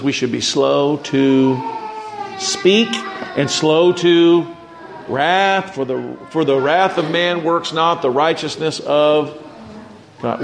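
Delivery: stretched slow, deliberate words; slow right down on slow to wrath; preacher stressing a verse about patience, slowly; slow emphatic scripture. Real, not acted. Quoting scripture, slow to speak.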